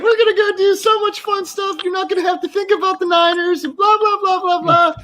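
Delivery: high, sing-song mocking imitation of his partner; mock old lady voice. Retelling his old lady's promises of fun in Miami, in a high mocking voice. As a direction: squeak out her cheery promises to mock them. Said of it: "And she's like".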